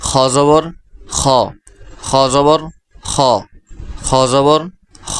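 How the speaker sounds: খ জবর খ chanted as a drill; teaching pronunciation. Shown as a demonstration. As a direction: model খ for the class. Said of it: "এর পরবর্তী অক্ষর".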